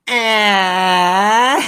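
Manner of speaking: drawn-out nasal wail; high, loud, whiny nasal "aah"; Fran. Retold or imitated. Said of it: "like in her most nasally".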